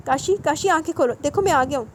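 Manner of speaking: high, loud pleading yell; frantic cry over a dying brother; desperate shout. A narrator's reading. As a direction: shout to wake a wounded brother. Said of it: "چیخ کر بولا".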